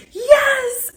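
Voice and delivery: very high squealed cheer; excited scream. Retold or imitated. Mother screaming as her girls hear, thrilled. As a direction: imitate your own delighted scream, high and drawn out. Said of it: "heard me scream".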